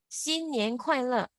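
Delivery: 新年快乐 slowly, syllable by syllable; repeat-after-me demo. Shown as a demonstration. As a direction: model 新年快乐 slowly for the class to copy. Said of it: "Let's repeat after me".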